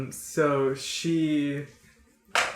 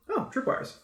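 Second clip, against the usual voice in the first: higher-pitched, lightly acted surprise; mock discovery; 10 semitones higher than usual. Game master acts out spotting tripwires, mock surprise. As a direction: play a sudden noticing.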